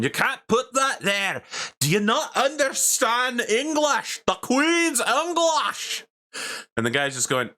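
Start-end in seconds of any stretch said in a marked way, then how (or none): 0.0-6.1 very high, outraged Scottish shouting; shouting Scottish man. Acted. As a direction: yell it in a thick Scottish accent, drawing words out. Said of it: "loud shouting ... a Scottish man going".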